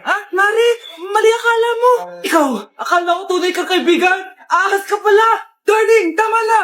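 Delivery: loud tearful melodramatic yelling; teleserye confrontation. Acted. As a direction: scream it like a soap opera. Wife catches husband with friend.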